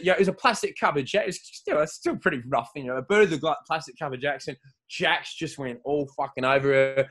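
ordinary talk

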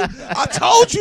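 high, loud and slow; drawn-out shout. Real, not acted. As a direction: stretch it out in a loud yell. Repeats boast, second take shouted.

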